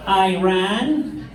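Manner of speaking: ordinary delivery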